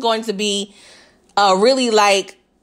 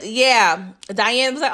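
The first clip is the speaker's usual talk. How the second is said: ordinary talk, nothing marked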